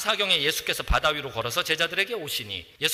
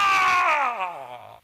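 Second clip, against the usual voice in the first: slow, drawn-out shout; hammed-up frightened shout; ghost shout; 5 semitones higher, 3 dB louder, much slower than usual. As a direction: yell in fright at a figure. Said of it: "그걸 보고".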